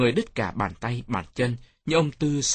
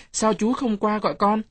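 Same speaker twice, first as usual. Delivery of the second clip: put-on high-pitched kid's complaint; reproachful child; 9 semitones higher, 3 dB louder than usual. A narrator's reading. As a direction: ask like a child who was left waiting, pitched up. Child reproaches late uncle. (giọng trẻ con cao, trách móc)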